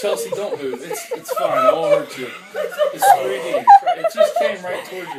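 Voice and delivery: high, loud laughing tease; excited teasing. Real, not acted. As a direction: squeal it out while laughing. Teasing friend with bird.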